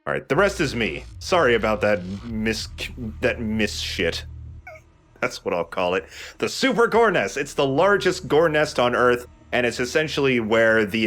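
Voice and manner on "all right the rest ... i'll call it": ordinary talk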